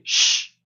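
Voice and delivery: sustained "sh" like shushing; unvoiced "sh" held alone for the X; demonstrated "sh"; hushing sh sound. Shown as a demonstration. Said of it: "som de X mesmo".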